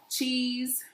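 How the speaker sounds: plain reading voice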